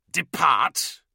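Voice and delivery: angry snarl at the news. Acted. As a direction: spit the word out in anger. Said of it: "Dellarax snarled".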